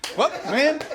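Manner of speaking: high, laughing, flustered imitation; mock flustered local. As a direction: play a giggly guy who can't say Florida. Imitating awkward audience member.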